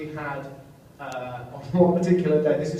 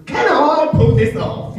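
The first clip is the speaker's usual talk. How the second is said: much higher and louder, slow and hammy; big comedic voice; 12 semitones higher, 12 dB louder, slower than usual. Retold or imitated. Actor imitating a co-star's big comedic voice, slow and hammy. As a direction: ham it up like a comic double act. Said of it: "his big comedic voice".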